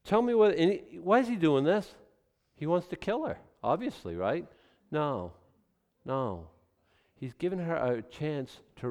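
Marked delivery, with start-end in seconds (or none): none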